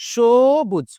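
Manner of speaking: সবুজ stretched as 'shooo-buj'; drawn-out teaching model of সবুজ with sh; correct sh pronunciation. Shown as a demonstration. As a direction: hold the first syllable of সবুজ after an sh. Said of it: "এই উচ্চারণটার নাম হচ্ছে".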